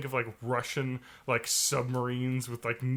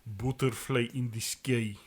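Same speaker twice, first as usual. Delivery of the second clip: lower, softer mock Russian accent; low voiced Russian; 4 semitones lower, 5 dB quieter than usual. Friend showing the low-voiced Russian accent. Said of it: "the low voiced Russian".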